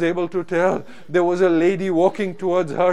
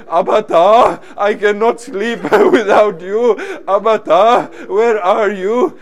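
louder, pleading call to a father; 5 dB louder than usual. Retold or imitated. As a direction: call out for a lost father, stretching the last words. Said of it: "calling out".

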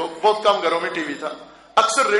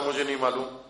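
low, soft, unbothered answer; calm reply; 8 semitones lower, 8 dB quieter, faster than usual. Retold or imitated. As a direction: quote it quietly, pitch dropped and matter-of-fact. امام کا پرسکون جواب نقل. (دھیمے، پرسکون لہجے میں)

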